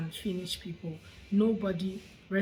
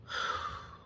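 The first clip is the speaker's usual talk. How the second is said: long breathy sigh; 6 dB quieter than usual. Real, not acted. Vlogger pausing after reading out the comments, sighing. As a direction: let out a heavy exhale.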